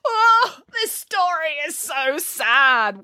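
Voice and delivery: high, slow, drawn-out wail. Acted. Listener overcome by the sad story, wailing. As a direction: blubber it theatrically, stretching each word. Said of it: "wailed Boris".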